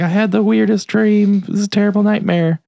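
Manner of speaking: high-pitched imitation of a tearful woman; mock crying. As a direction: put on a high, weepy voice. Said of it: "then she starts crying".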